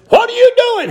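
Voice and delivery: high-pitched challenge from a stranger; acted-out incredulous question; startled demand. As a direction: call out the question high and sudden.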